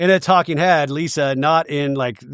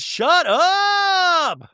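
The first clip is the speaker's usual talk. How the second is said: very high, long drawn-out squeal; hammed-up disbelief, stretched high on the last word; mock astonishment. Imitating Mary reacting to the gossip, mock astonishment. Mimics Mary's shocked reaction.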